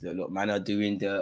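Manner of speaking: normal talking voice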